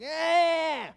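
put-on high, loud toddler wail; imitated whiny cry. Parent mimicking kids who can't talk yet, whiny wail. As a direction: imitate a child's wordless whiny demand. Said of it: "nasty, whiny cry".

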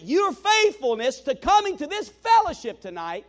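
loud, high, energized thanks from the pulpit; grateful preacher lifting up the room; passionate preaching. Acted. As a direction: pour your energy into the thanks.

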